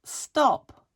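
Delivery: loud sharp stop; firm loud command to a device; teacher voice. Real, not acted. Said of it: "She made me use my teacher voice".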